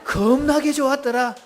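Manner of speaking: high Jeolla call-out; Jeolla dialect. Shown as a demonstration. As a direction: exclaim it in Jeolla dialect for others to repeat. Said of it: "전라도 버전 네 가지나 있습니다". (높은 목소리의 전라도 사투리)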